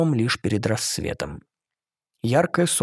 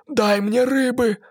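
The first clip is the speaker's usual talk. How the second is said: put-on high, childlike babble; babbling mermaid plea; 11 semitones higher, slower than usual. A narrator's reading. Mermaid babbling to her captor for fish. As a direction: babble it like a helpless sea creature. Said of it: "пролепетала она".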